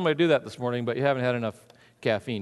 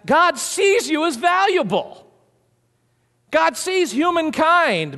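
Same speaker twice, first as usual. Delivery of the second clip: higher, slow stress on "sees"; rising preacher's emphasis; emphatic preaching; 9 semitones higher, 4 dB louder, slower than usual. Acted.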